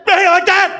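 loud, high, laughing yell; dirty talk shouted by accident; sudden shout. Retold or imitated. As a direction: blurt it out way too loud. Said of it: "Shouldn't have shouted at you".

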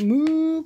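a high playful moo. A narrator's reading. Reading a picture book, a playful high cow moo. Animal noises in the bank.

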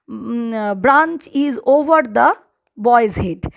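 deliberate word-by-word example; slow example for pupils; slow dictation. Shown as a demonstration. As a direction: read it out slowly. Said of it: "dictating example sentence".

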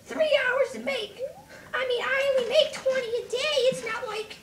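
very high, indignant cartoon whine; high-pitched rant of an offended potter; angry Link voice. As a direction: complain in a squeaky falsetto. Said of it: "Symbol Link comes out".

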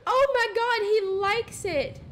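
high, loud, slow squeal of delight; excited shriek. Real, not acted. Gamer shrieking as her sim enjoys the kiss, excited shriek. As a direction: shriek with gleeful disbelief.

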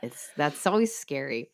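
normal talking voice

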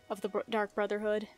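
ordinary talk, nothing marked

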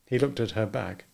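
usual speaking voice

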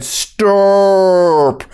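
drawn-out mock intervention; sane intervener. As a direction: hold out one long, firm word. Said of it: "and said".

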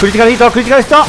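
high loud shout of the attack; screaming attack name. Real, not acted. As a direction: yell it as the blow lands.

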